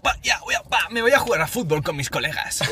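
put-on high cocky teen voice; cool kid voice. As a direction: play the cocky teenager. Mocking cool-kid impression. (voz de chaval chulito, aguda)

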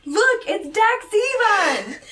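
high, drawn-out excited shout; hammed-up, slow holler; mock yell. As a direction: yell it out in playful alarm. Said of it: "and he yells".

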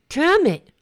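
reliving the anger in her car, a sharp loud curse; angry outburst. Retold or imitated. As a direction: burst out in frustration. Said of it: "I was just pissed".

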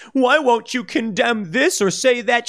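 mock the virtue-signaler's nagging voice; mocking whiny critic.

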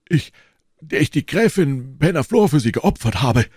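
theatrical, aggrieved outburst; indignant Porthos. A narrator's reading. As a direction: press the reproach with pompous force. Porthos presses his reproach.

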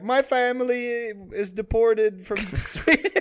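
high, slow, mock-tearful Swedish voice; crying Swedish accent. Insulted Swedish caller telling of deported family, mock-crying Swedish accent. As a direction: play a Swede pretending to cry. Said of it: "And then he starts crying".

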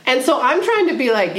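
ordinary talk

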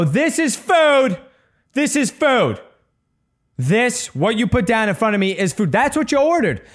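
very high-pitched, hammed-up outrage; mock indignation. Mock diner protesting at a restaurant, hammed-up indignation. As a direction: absurd complaint, voice leaping way up. Mocking heckler with restaurant analogy.

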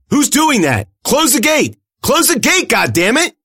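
high, loud furious shout; panicked angry shout. A narrator's reading. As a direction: scream the order into the radio. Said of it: "Alani shouted into her radio".

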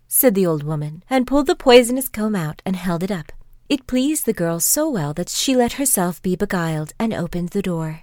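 ordinary delivery

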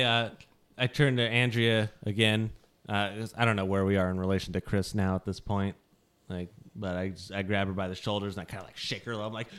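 normal talking voice

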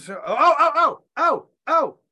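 excited outburst. Real, not acted. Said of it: "first off, drink your Dubby".

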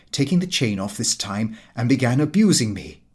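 usual speaking voice

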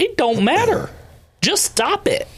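high, exasperated just stop it; fed up, wave it off sharply; exasperated retort. Retold or imitated. Talking back to critics, high and exasperated. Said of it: "I'm looking, going".

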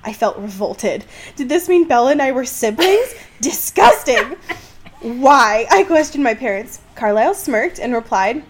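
high, loud giggling reading; laughing while reading. Real, not acted. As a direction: fight through the laughter. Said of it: "I'm literally sobbing right now".

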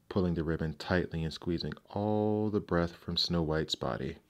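ordinary delivery, nothing marked

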